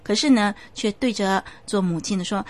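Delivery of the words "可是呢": usual speaking voice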